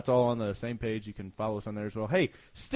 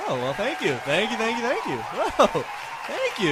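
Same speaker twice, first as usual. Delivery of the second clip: mock applause thanks; 8 semitones higher, 7 dB louder than usual.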